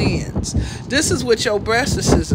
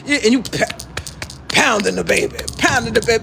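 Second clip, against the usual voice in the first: ordinary talk, nothing marked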